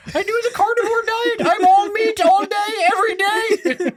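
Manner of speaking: high, whiny indignant voice; Jordan Peterson impression. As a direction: whine it like an offended pundit. Impression continues.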